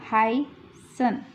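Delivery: हाइसन drawn out very slowly with a clear ह; slow demo. Shown as a demonstration. Teacher slowly modelling हाइसन for the class. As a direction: stretch हाइसन slowly, opening on ह. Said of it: "हा की sound ह है".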